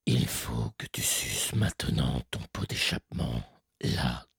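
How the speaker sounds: very low, slow menacing growl; serial killer voice. Retold or imitated. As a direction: growl it from behind like a slasher. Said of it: "je leur dis".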